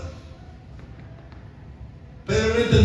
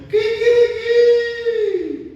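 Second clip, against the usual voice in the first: very high, long crowing imitation; loud drawn-out cock-a-doodle-doo, falling at the end; rooster crow; 12 semitones higher, 5 dB louder, much slower than usual. Preacher imitates the rooster after Pedro's denial, crowing. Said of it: "Cantó el gallo".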